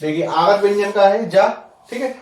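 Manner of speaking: ordinary talk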